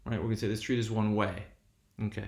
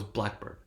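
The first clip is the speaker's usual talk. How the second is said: "blackbird", secondary stress on "bird"; compound stress; 2 semitones lower, 7 dB quieter than usual. Shown as a demonstration.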